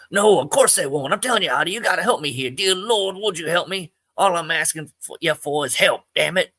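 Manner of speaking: put-on panicked old man begging his friend for help; desperate plea. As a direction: cry out to him and to the Lord.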